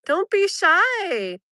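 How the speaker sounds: high, slow sweet coax. Real, not acted. Speaking to the lizard, slowly coaxing it out. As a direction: lure the shy lizard out gently.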